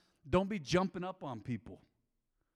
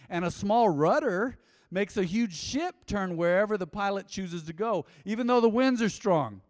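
louder scripture reading that swells to a near-shout on the winds; preaching scripture; 4 semitones higher, 8 dB louder than usual. Acted. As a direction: read the verse like a preacher building up steam. Reads scripture with rising force.

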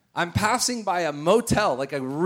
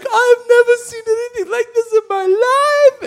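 loud, very high mock wail; imitating man losing it; 10 dB louder than usual. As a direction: cry it out, hammed-up and overwhelmed. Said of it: "The Indian man is losing it".

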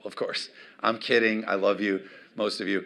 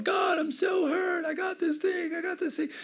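put-on whining like a hurt child. Pastor mocks the congregation whining to God like kids. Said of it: "All of us down here are going".

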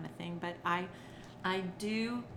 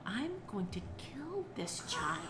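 exasperated thought; 5 semitones higher, 5 dB quieter, slower than usual. Retold or imitated. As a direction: mutter in fed-up disbelief. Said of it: "And I thought".

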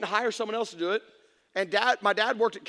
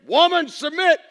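mock bossy command; 5 semitones higher, 8 dB louder than usual. Mocking husbands ordering wives.

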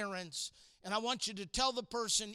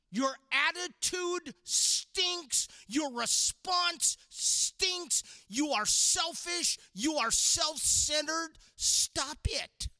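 stern rebuke; 5 semitones higher, slower than usual. Real, not acted. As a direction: scold them firmly. Preacher scolding the teens.

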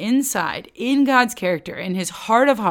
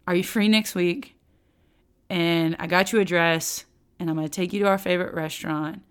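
a hint of a soft, lower, sheepish husband; lightly voiced husband backing down, lower and softer; apologetic husband; 5 semitones lower, 5 dB quieter than usual. Husband apologizing to his upset wife, softly and a little lower.

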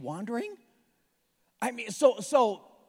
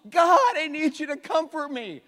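mock plea to God; 7 semitones higher, 7 dB louder than usual. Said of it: "After we get dumped".

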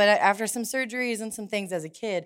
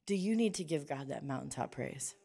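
usual speaking voice

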